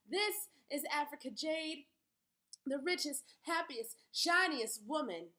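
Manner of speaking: loud, high and grand, showing herself off; proud announcement. Acted. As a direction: proclaim it to the whole store. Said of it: "says to everyone".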